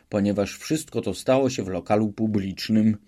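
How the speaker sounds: ordinary delivery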